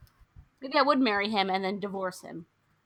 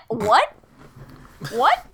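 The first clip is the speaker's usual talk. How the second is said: loud, high, rising disbelief; incredulous; 6 semitones higher, 9 dB louder, slower than usual. Real, not acted. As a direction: throw it out louder and higher, appalled. Outraged reaction to retold insult.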